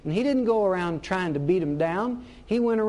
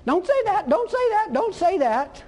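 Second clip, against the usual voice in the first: much higher, louder fussy nagging; mock nagging scold; 11 semitones higher, 3 dB louder than usual. Imagined Jesus nagging a careless speaker, high mock scold. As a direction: squeal a repeated warning. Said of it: "come along and say".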